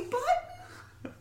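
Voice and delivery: squeaky high word breaking into laughter; cracking up mid-impression; laughing falsetto. [name] finishing his innocent answer to Carrie, laughing squeak. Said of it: "say it in that tone of voice".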